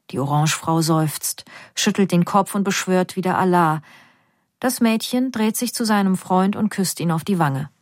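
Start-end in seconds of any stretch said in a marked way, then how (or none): none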